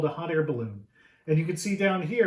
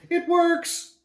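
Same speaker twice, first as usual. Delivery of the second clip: much higher and louder, triumphant; excited cheer; 13 semitones higher, 7 dB louder than usual. Acted. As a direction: burst out like the engine finally caught. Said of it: "and he's like".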